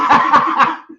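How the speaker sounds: loud high cackle of laughter; burst of laughter. Real, not acted. Friend cracks up at the stalling story, loud high laugh. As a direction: break into a big loud laugh.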